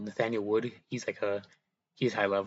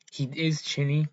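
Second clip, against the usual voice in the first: ordinary talk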